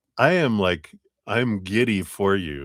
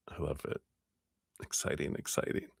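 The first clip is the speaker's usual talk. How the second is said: much softer, whispered excitement; hushed; 5 semitones lower, 14 dB quieter than usual. Real, not acted. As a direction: breathe it quietly with delight. Hushed delight.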